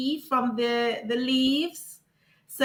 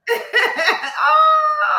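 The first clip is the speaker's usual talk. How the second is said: loud high laugh; 7 dB louder than usual. Real, not acted. Laughing with the guest.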